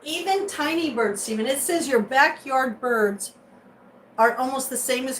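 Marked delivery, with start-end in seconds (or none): none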